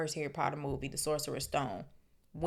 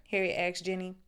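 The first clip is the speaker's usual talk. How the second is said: ordinary delivery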